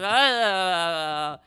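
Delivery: drawn-out wobbling "uh"; imitated water willy. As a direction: make a long wordless sprinkler noise. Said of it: "like watching a water willy".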